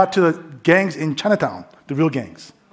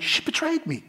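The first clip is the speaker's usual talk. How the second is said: stunned realization; 11 semitones higher, 8 dB quieter than usual. Retold or imitated. Seeing the girl run away.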